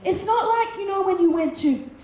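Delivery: ordinary talk, nothing marked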